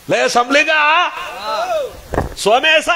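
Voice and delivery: high, carrying calls; calling out names. Acted. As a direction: summon someone from across the way. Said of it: "ಇವನ್ನು ಕರೆದಂಗ ಆಗ್ಬೇಕು".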